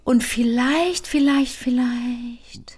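very slow, drawn-out words; stalling. Real, not acted. Co-host stalling over a good idea, very slow and drawn out. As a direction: stretch each "vielleicht" out. Stalling before trying the accent.

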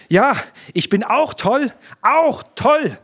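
high, loud, slow cry of madness; frenzied outburst. A narrator's reading. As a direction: cry out as if losing your wits. Enraged Konrektor declares himself mad. (außer sich, hoch gerufen)

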